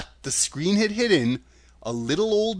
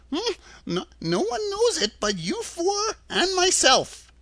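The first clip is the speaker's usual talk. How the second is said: put-on very high, hesitant old-man voice; high-pitched, stammering confession; nervous little man. A narrator's reading. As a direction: admit it timidly, faltering.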